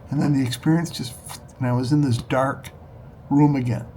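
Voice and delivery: ordinary talk, nothing marked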